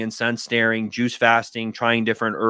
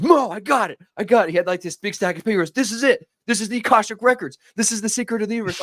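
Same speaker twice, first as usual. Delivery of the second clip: high, thrilled rush of discovery; excited buddy; 10 semitones higher, faster than usual. Retold or imitated. Buddy bursting in with his stack of papers, wildly excited. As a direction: gush like you've found the secret of everything.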